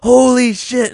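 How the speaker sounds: high, loud astonished exclaim; mock amazement. Retelling his reaction to the soda fight, loud and high. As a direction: blurt it out amazed. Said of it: "I was like".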